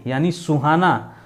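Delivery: ordinary talk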